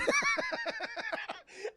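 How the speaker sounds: very high sustained screeching noise; crazed shriek. Acted. Streamer acting out Zod freaking out, crazed shriek. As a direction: screech like someone losing his mind.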